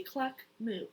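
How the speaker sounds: ordinary delivery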